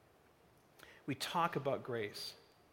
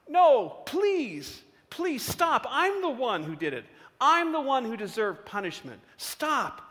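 high, loud, anguished begging; acted tearful outcry; sobbing plea; 8 semitones higher, 7 dB louder than usual. A narrator's reading. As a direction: plead desperately like a guilt-stricken boy. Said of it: "the boy sobs".

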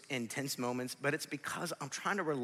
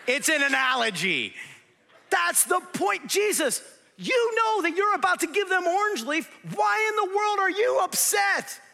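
very high, loud rant; exasperated full-force preaching; 11 dB louder than usual. Real, not acted. Preacher pressing his ice cream analogy, exasperated shouting.

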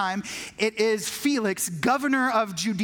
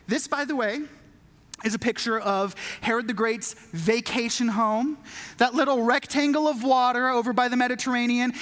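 ordinary talk

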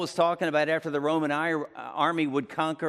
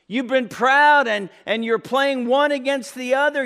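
high, loud, confronting preacher; full-force preaching; 10 semitones higher, 5 dB louder than usual. Acted. Preacher confronting a proud congregation with Paul's rebuke, forceful and high. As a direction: challenge their pride, pitched high and forceful.

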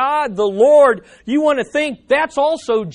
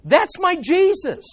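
joyful exclamation; 7 semitones higher, 3 dB louder than usual. Retold or imitated. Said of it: "we need instead be thinking".

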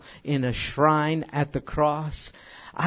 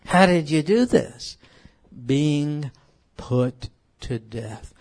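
ordinary talk

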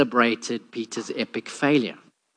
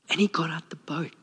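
whisper; 9 dB quieter than usual. Acted. Dramatic pause in Peter story.

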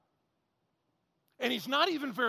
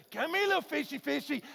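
lightly higher pet-calling voice; calling a kitty; 6 semitones higher than usual. Retold or imitated. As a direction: coax it like calling a cat. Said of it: "instead of little kitty, kitty, it's like".

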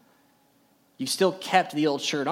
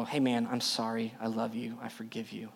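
tender forgiveness; 5 semitones lower, 7 dB quieter than usual. Acted. Voicing forgiving the person who hurt you.

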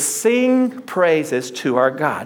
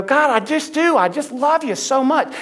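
higher, rising worshipful outpouring; fervent praise; 8 semitones higher than usual. Retold or imitated. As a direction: pour out love to God, lifted up. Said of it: "you go".

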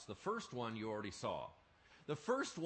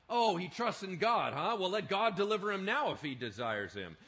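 louder and higher, sarcastic jeer; scoffing mockery; 6 semitones higher, 8 dB louder than usual. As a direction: sneer at his faith. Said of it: "They scoffed at him".

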